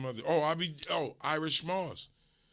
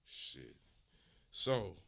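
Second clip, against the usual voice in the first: usual speaking voice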